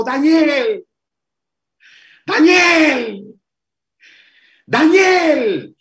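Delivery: a king calling a name into the pit, rising; calling out sadly. Acted. King calling Daniel at the lions' den, sad cry. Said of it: "llamó a voces a Daniel con voz triste".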